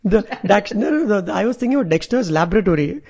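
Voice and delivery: normal talking voice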